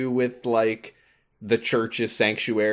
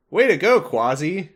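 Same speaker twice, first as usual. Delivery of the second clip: louder, high-pitched pretend encouragement; mock cheer; 6 semitones higher, 5 dB louder than usual. Sora watches the rescue and then congratulates Quasimodo, in mock cheer. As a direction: cheer on a friend as a mock onlooker.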